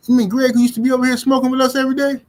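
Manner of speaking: high, loud, fast disbelief; mock disbelief at the news; incredulous. A second stoner doubts his friend's story, incredulous. Other stoner questions in retold chat.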